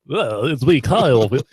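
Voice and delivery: cartoon rabbit as sports announcer; Bugs Bunny. Host joking that a cartoon rabbit calls the game, cartoon voice. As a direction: do a playful cartoon play-by-play. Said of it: "They got Bugs Bunny".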